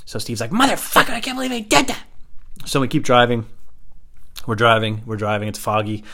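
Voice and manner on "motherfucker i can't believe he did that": high-pitched mock outrage; outraged retort. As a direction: imitate a friend's incredulous high outburst. Steve's outraged reaction, acted.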